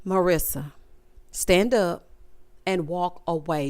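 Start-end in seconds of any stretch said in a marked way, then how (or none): none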